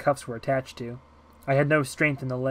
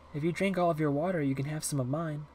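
put-on little girl, kindly offering; 2 semitones higher, 5 dB quieter than usual. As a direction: offer it softly, like a small girl.

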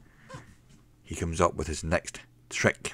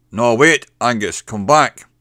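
calling out; 6 semitones higher, 12 dB louder than usual. Acted. Boss calls barman back.

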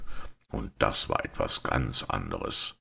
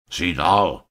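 loud, high angry outburst; furious narrated shout; roaring; 7 semitones higher, 8 dB louder than usual. A narrator's reading. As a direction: bellow the insult at him. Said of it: "brüllte er jenen an".

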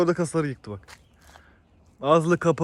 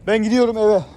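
higher, louder announcement; calling out; 6 semitones higher, 9 dB louder than usual. Real, not acted. Man telling the animals loudly he is going home. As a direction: call across the yard that you're heading home.

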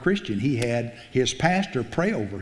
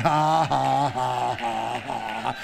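mocking laughter; much slower than usual. Hagin laughing at the devil.

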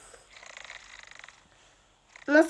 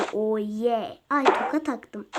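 normal talking voice

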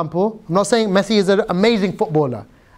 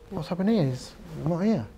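very soft, crestfallen murmur to himself; barely voiced, let-down aside; quietly sad; 3 semitones lower, 11 dB quieter than usual. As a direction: act out hushed disappointment. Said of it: "he felt really sad".

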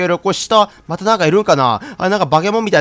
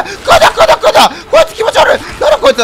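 high, panicked squeals at a creepy monster; freaked out; 5 dB louder than usual. Real, not acted. Freaked-out high cries at the monster. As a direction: shriek in real disgust and alarm.

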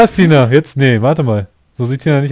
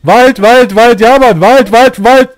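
rising panicked shouts, higher and louder; excited yelling; 6 semitones higher, 5 dB louder, much slower than usual. Real, not acted. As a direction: yell in building panic as the car swerves. Panicked swerving toward the forest.

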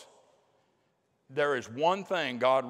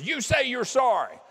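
stern teacher; 9 semitones higher, 4 dB louder than usual. Retold or imitated. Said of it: "That teacher grabs him and goes".

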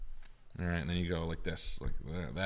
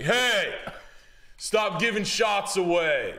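much higher, louder scolding, slow on the end; mock bossy boss; 13 semitones higher, 5 dB louder, much slower than usual. Boss scolding the bartender, mock high and loud. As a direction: ham up the boss telling him off.

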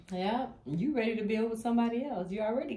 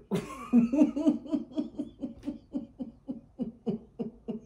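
high, helpless laughter; long run of high cackling; long real laughing fit; 6 semitones higher than usual. Real, not acted. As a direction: crack up and keep going. Cracks up at Craig's confusion.

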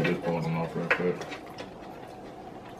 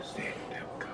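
whisper; 16 dB quieter than usual. Real, not acted. Cheese pull moment.

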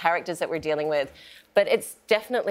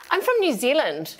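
higher, exaggerated New Zealand accent; strongest Kiwi accent; 6 semitones higher than usual. Pleading to the cop who pulled her over, thick Kiwi accent. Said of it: "I said it in the strongest Kiwi accent".

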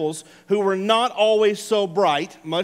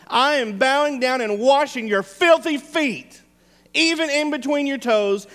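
fervent preaching; 6 semitones higher than usual. Acted. Preacher voicing Jesus to his disciples: building fervent preaching. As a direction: drive home the love behind washing feet.

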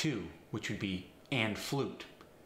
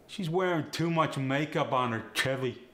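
cockney accent; 3 semitones higher, 4 dB louder than usual. Shown as a demonstration. Example sentence in Cockney slang.

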